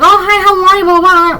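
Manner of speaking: high, sing-song fake niceness; mock sugary greeting; fake friendly greeting. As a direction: mimic a phony sweet hello. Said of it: "they're gonna be like".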